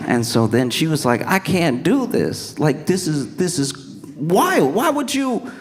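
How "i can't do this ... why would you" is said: climbing from high to shrieky-high disbelief; exasperated protest. Acted. Woman confronting a near-stranger's wedding plan, acted rising exasperation. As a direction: build from stunned refusal to squealing exasperation.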